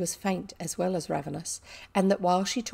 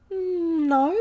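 hesitant, higher-pitched drawn-out no; faltering; 10 semitones higher, 6 dB louder than usual. A narrator's reading. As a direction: falter on a hummed start. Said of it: "Ermengarde faltered".

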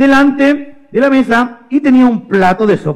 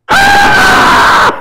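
sudden piercing high shriek; hammed-up scream from a burnt mouth; scream of pain; 8 dB louder than usual. María tasting the burning soup, sudden loud scream. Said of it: "y dijo".

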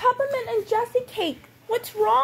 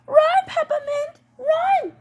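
higher, louder cry to run; panicked warning; 5 semitones higher, 4 dB louder, slower than usual. Acted. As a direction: yell in a panic. Fleeing doll warns friend.

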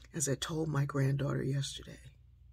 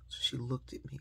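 normal talking voice

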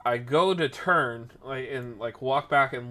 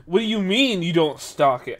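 raised-pitch, loud mock indignation; indignant customer; 5 semitones higher, 7 dB louder than usual. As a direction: imitate an affronted customer, high and loud. Retold customer challenges him indignantly.

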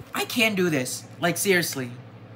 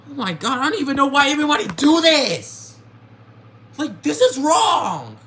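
higher, louder, exasperated shouting; real anger; 7 semitones higher, 4 dB louder than usual. Real, not acted. Artist venting at whoever copied her art, furious near-shout. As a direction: yell it out in disbelief, truly fed up. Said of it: "I am very angry right now".

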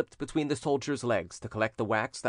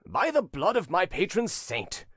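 raised, higher-pitched oath; stentorian cry; 6 semitones higher than usual. A narrator's reading. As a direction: swear it out in a big voice. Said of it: "cried a stentorian voice".

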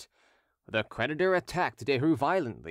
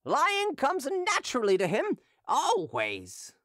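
put-on drunken hawker; 13 semitones higher, slower than usual. Drunken hawker taunting Derues before the crowd, high mocking voice. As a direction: taunt him gleefully like a drunk street seller.

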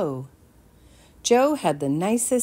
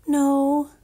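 put-on despairing boy; 10 semitones higher, 4 dB louder than usual. Jameson undecided.

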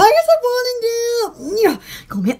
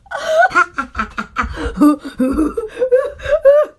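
long giggling fit. Real, not acted. Long laugh at own joke.

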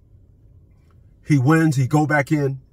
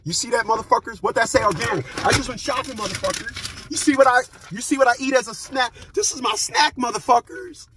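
mock squeaky brag; high taunting voice; 8 semitones higher than usual. Vlogger brags about his snacks to viewers, high and teasing.

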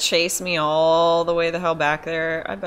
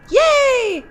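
very high, loud yay; excited cheer; 7 dB louder than usual. Real, not acted. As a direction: squeal with relief. Escaping in game.

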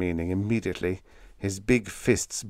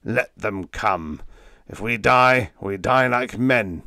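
slow, defiant rasp; deliberate, grim defiance; vicious rasp; 2 semitones higher, 5 dB louder, slower than usual. A narrator's reading. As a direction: rasp it out, ready to die fighting. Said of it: "rasped Hansen viciously".

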